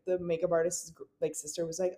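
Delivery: ordinary talk, nothing marked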